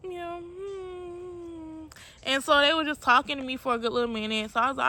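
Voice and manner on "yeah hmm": tearful. Retold or imitated. Thanked by strangers while crying.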